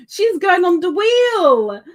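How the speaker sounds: high, loud Southern shout; drawn-out put-on Southern cheer; generic Southern accent. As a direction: holler it slowly in mock Southern. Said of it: "doing a generic Southern thingy".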